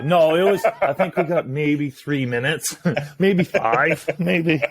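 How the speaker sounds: laughing through the words, pitched high; giggly admission, voice jumping up. Real, not acted. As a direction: chuckle out the numbers, amused at yourself. Laughing at how short the wait was.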